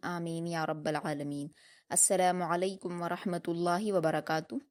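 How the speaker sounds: soft, measured Arabic invocation; opening dua before class; prayer recitation. Real, not acted.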